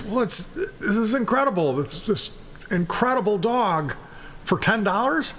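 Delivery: high-pitched, halting astonishment; incredulous buyer. Acted. Buyer marvels at dog for ten dollars, high incredulity. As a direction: sputter in acted disbelief.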